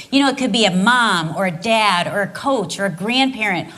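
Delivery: ordinary delivery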